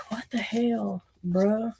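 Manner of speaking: hushed, breathy disbelief under the breath; whispered what the hell; retold whisper. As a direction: mutter it like a private aside. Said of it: "And I was like".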